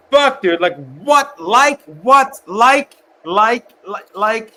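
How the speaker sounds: high, loud exasperated yelps; exasperated outburst. Real, not acted. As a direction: throw up your hands, pitch shooting up. Ranting about filler words.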